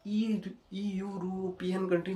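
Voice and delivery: mock the mistake, ee-uropian, drawn out; wrong pronunciation. Shown as a demonstration. Teacher warns students against this reading. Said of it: "मत बोल देना ऐसे".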